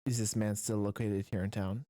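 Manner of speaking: low, grave question; dead serious. Acted. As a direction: drop the voice and ask in deadly earnest. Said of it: "goes from delight to absolute dead seriousness".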